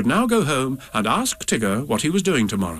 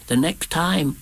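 raised little Piglet voice; Piglet relaying; 5 semitones higher, slower than usual. A narrator's reading. Piglet passes Rabbit's message to Pooh, small raised voice. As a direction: pass the message on, pitched up and less drawn out. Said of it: "said Piglet to Pooh".

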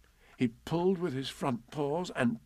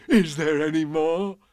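loud, higher, eager question; eager bear pouncing on the chance; quick, eager Pooh; 5 semitones higher, 7 dB louder than usual. A narrator's reading. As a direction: jump in keenly, louder. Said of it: "asked Pooh quickly".

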